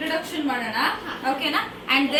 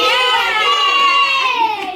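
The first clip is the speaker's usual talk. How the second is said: high, loud yay; excited cheer; 9 semitones higher, 8 dB louder than usual. Real, not acted. Aunt cheering the kids into the prank video, high and loud. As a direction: cheer like the fun is starting.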